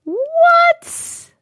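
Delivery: high loud incredulous cry; stunned by the twist; shocked. Real, not acted. Streamer shocked by the story's twist, crying out high.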